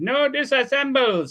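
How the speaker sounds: high-pitched robot voice; Johnny Five. Acted. Movie robot facing disassembly, high robot voice. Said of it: "he talked like a robot".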